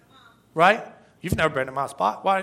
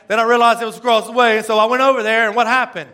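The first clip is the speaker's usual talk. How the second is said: excited telling; 4 semitones higher, 6 dB louder, faster than usual. Real, not acted. Excited storytelling climax.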